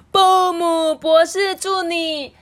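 high, loud, slow drawn-out call; echoing birthday cheer. A narrator's reading. Guests to doctor across the grass, long ringing shout. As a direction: holler it across the meadow. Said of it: "而且回音呢， 在草地上面缭绕了许久".

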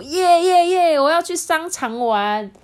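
high, loud gleeful cheering; whooping, excited kids; happy cheer. Acted. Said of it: "大家就很开心的说".

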